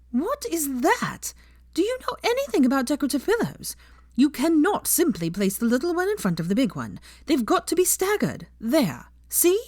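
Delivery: put-on haughty high-pitched girl voice, fussing; prim know-it-all lecturing about pillows; Pansy, bossy and high. A narrator's reading. Pansy lecturing Hermione on decorating, haughty and high.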